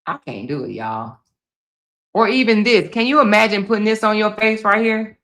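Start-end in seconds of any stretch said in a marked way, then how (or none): 0.1-1.2 much softer, lower, resigned; soft aside. Real, not acted. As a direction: murmur it to the viewers. Drops voice after outburst.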